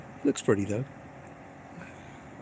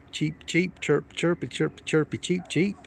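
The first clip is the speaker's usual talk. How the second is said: high chirpy cheeps; playful high chirping; bird cheeps; 6 semitones higher, slower than usual. Pretend. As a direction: chirp like a little budgie. Imitating the bird.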